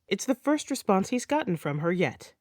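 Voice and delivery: plain reading voice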